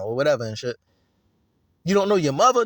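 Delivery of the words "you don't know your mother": loud, high, incredulous ask; a friend shocked at hearing he has no mother, loud and high; disbelieving question. Acted. Acted street conversation.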